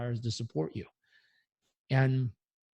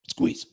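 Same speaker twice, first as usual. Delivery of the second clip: quick sharp gasp through the nose, then squeeze; 7 dB louder than usual. Shown as a demonstration. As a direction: gulp in that last bit of air. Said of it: "let's try it".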